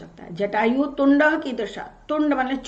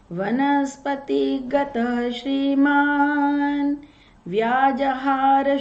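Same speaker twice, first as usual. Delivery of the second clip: slow shloka recitation; 3 semitones higher, 4 dB louder, much slower than usual. Shown as a demonstration. Teacher reads the new shloka aloud to students, slow measured recitation. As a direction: chant the Sanskrit verse slowly, each word drawn out. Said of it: "fourth श्लोक आ गया".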